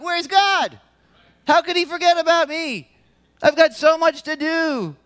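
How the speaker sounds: high, whiny complaint, hammed up; mock whining. Churchgoer whining to God about lost internet, high and hammed up. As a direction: play a spoiled person without internet. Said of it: "some of us are like".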